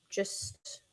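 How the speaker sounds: breathy whispered aside. Real, not acted. Streamer confiding to her viewers in a whisper. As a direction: lean in and whisper it.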